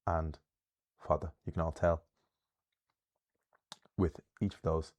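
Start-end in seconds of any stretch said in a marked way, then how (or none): 1.0-1.3 "fada" stressed on the first syllable; initial stress. Shown as a demonstration. Teacher ending a list of common Irish words, initial stress. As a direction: lean on FA in "fada", light ending. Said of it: "initial stress".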